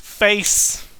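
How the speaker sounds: ordinary talk, nothing marked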